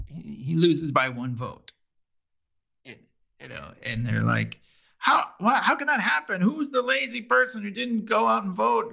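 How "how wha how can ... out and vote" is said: high-pitched, imitating indignant party guests; acted-out incredulous crowd; mock outraged disbelief. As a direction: demand answers in a raised voice. Voicing outraged party guests after loss.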